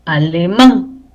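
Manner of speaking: "alemán" slowly, stressed on MÁN; stress on last syllable. Shown as a demonstration. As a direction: land on the final syllable of "alemán". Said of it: "tilde en alemán".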